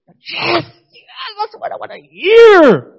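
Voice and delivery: very high, loud squeal of triumph; acted elation. Young immigrant told he sounds born here, squealing elation. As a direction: squeal in mock delight, straining upward. Acts out delight at being complimented.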